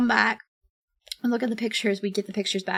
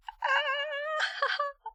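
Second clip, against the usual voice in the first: high squealing laugh; laughter; 5 dB quieter than usual. Real, not acted. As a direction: let out a high, thin laugh that breaks into giggles. Laughing about her mom.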